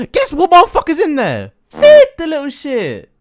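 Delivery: big reveal. Real, not acted. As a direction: shout the reveal like a gotcha.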